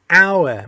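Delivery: "hour" begun on the vowel, no h sound; silent H. Shown as a demonstration. Teacher showing learners why it takes an, "hour" with silent H. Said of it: "Hش تلفظ نمیشه".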